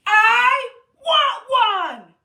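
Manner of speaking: slow, loud, high wailing cry; crying shout. Shown as a demonstration. Teacher wailing with the kids after a deep breath, crying shout. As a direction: howl it like a crying toddler. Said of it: "Take a deep breath".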